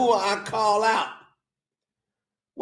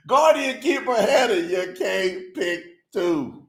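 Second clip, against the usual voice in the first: slow mock scolding; 2 semitones higher than usual. Real, not acted. Host teasing a voter who picked two, slow mock scolding. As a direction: draw out "can't pick two" word by word. Repeats rebuke slowly with full title.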